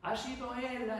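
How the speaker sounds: put-on high-pitched child's voice; whiny child. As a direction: play a child blaming another kid. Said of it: "los niños que juegan, corren, pelean, gritan".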